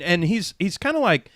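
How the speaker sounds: ordinary talk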